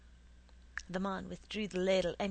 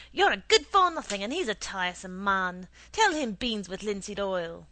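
berating; 11 dB louder than usual. A narrator's reading. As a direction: berate the boy, then command him. Mother berating her son.

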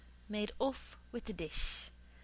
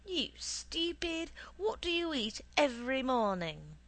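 scolding; 5 semitones higher than usual. A narrator's reading. Mother scolding son in story.

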